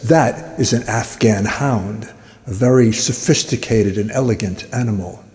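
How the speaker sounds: understated know-it-all; connoisseur's remark. Acted. Bystander showing off dog knowledge.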